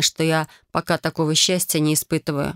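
ordinary delivery, nothing marked

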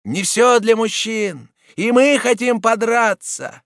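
high, slow, loud demand; women shouting. A narrator's reading. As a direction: call it out defiantly as a brawling woman. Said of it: "кричали остальные женщины".

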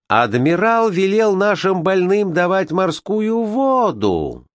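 high, slow outraged crowd voice; shouted from the crowd. Acted. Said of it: "закричали в толпе".

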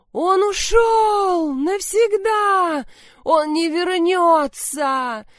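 hysterical shout. Acted. Milena's hysterical despair.

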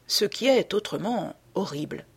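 usual speaking voice

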